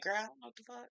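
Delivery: half-voiced, under the breath; hushed aside. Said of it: "She was just looking like".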